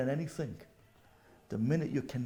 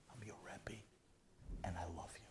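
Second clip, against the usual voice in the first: very soft, hushed loving words; tender whisper; 5 semitones lower, 18 dB quieter, faster than usual. Retold or imitated. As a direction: whisper it like a blessing.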